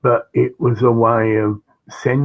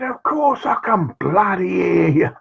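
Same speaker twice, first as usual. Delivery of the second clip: higher-pitched, put-on protest; mock indignant retort; 7 semitones higher than usual. Man answering the shouting masseur, mock indignant. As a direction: fire back in a raised, put-on voice.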